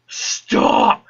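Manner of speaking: loud, rough, voiceless yell; mock desperate shout. Scientist begs Doom Guy to stop smashing, mock desperate shout.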